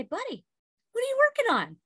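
asking son. Retold or imitated. Mom voicing herself to son.

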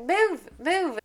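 high urgent double move; urging move. Acted. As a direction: push them to get going. Telling people to move.

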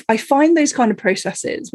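normal talking voice

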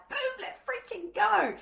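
very high, slow excited squeal. Acted. Coach greeting a client coming into her world, excited squeal. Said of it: "I wanna get excited".

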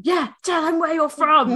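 high-pitched imitation of a goading friend; mock egging-on. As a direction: cheer them on like a bad hype man. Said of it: "funny the way you were going".

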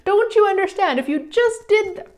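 mock pleading, pitched high; exasperated convincing. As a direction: play the frustrated persuader, hands up. Mocking her old convincing self.

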